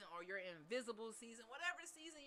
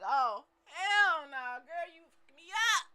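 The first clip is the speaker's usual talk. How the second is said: loud, drawn-out mock wail, higher; future self crying out; 5 semitones higher, 15 dB louder, slower than usual. Future self yelling back at her in a loud mock wail. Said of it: "your future self crying and like".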